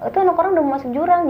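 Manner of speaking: hurried scolding shout; urgent retold cry, fast; retold yell, fast. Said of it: "Gue teriak dong".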